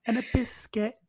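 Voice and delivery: a light mock British lady; British accent. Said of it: "I'm going to imitate her".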